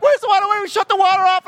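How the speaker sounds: high, loud reenacted scream; panicked screaming. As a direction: yell it frantically in a shrieking high pitch. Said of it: "And I'm screaming".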